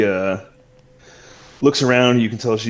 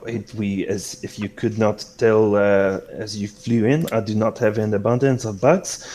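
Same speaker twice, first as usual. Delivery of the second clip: put-on accommodating host with a French accent; courteous French-flavored hostess. Celeste answering Yafai's request for bugs, gracious French-accented hostess. Game master plays Celeste.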